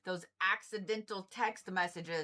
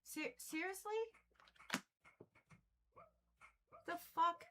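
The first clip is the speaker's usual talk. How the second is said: higher, softer, stunned disbelief; can't believe what she sees, softer; 9 semitones higher, 11 dB quieter than usual. Real, not acted. Tarot reader seeing a bad card, stunned disbelief. Reacts to card flipping sideways.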